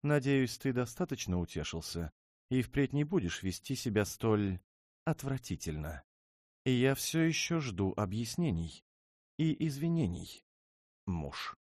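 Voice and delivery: high, loud, imperious demand; haughty princess. Acted. Princess demands an apology from her husband, imperious and high. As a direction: princess looking down on him.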